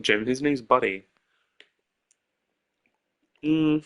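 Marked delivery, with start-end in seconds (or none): none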